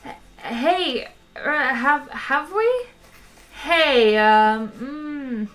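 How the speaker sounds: high, halting, stammered hedging; awkward surprise. A narrator's reading. Zaphod caught out by having met Arthur, awkward surprise. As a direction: stall awkwardly, with hesitant drawn-out sounds. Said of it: "gave an awkward start of surprise".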